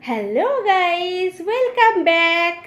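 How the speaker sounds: loud, slow, drawn-out hello; excited greeting. Real, not acted. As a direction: throw a big cheery welcome to viewers. Second, bigger vlog greeting.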